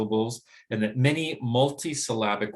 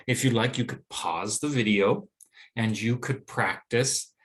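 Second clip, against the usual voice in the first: ordinary talk, nothing marked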